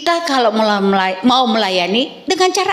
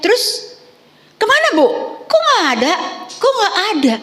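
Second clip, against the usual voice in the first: much higher, puzzled questioning voice; mock congregation asking; 9 semitones higher than usual. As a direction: play a nosy churchgoer asking where the kids went. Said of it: "Kalau kalian bertanya".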